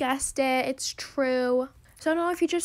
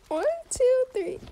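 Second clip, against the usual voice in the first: excited countdown; 10 semitones higher, slower than usual. Real, not acted. Counting down before accepting.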